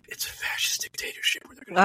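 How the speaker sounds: imitating a hushed whisper, fast; mock the friend's breathy ASMR hush; airy whispered mockery of the friend. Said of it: "in an A S M R voice".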